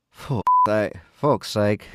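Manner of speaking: put-on a British accent creeping into the curse; slipped British accent. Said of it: "started being British again".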